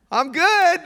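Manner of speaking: high, casual brush-off; breezy unbothered. Retold or imitated. Preacher voices the lady shrugging it off, high and casual. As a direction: toss it off like nothing happened. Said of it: "like, no problem at all".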